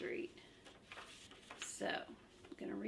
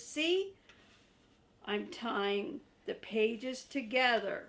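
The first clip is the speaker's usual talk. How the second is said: louder, high earnest puppet voice; Grover voice; 3 semitones higher, 7 dB louder than usual. Acted. Grover warns the reader about the monster, loud and earnest. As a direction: explain the danger urgently.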